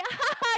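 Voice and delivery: a quick, high, loud nervous laugh; short awkward laugh. Retold or imitated. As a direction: brush him off with a laugh. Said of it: "looked at him and laughed".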